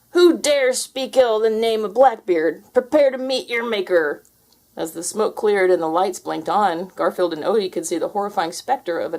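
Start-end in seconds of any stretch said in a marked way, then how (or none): 0.1-4.2 slow, loud, high pirate threat; menacing pirate ghost. Acted.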